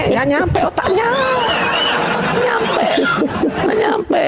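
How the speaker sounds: high shouted jeer with a laugh; mocking the opponent's brains in a shouted chant; laughing taunt. Real, not acted.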